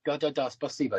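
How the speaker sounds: normal talking voice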